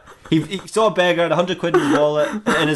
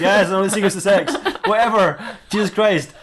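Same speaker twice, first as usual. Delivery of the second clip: high, mock-exasperated outburst; 6 semitones higher than usual. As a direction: act fed up, pitched up and half laughing. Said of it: "He goes".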